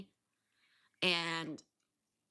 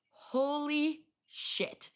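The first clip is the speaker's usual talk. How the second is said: drawn-out shock; 8 semitones higher, 4 dB louder, much slower than usual. Retold or imitated. Shocked reaction to location.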